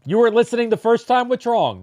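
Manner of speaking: mock scolding. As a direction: pretend to call them out, hammed-up. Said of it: "No, I'm kidding".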